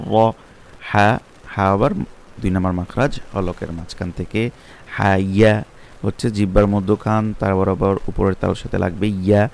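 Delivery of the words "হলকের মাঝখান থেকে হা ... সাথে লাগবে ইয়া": ordinary talk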